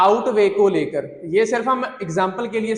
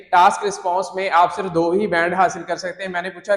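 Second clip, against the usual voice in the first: normal talking voice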